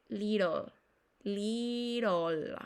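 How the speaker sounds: "little" with the T tapped like a Spanish single r; American "little", sounding like "liRol". Shown as a demonstration. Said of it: "se convirtieran en una R".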